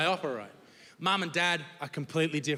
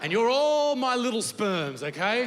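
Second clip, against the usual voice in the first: louder, slow, addressing the crowd as little sperms; hammed-up slow mock address to his sperms; pretend sperm leader; 7 semitones higher, 7 dB louder than usual. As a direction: boom it out like the boss of the scrotum. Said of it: "Let's pretend we're in a scrotum".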